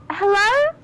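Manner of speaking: calling out. Acted. Minx calls out into the darkness, calling hopefully.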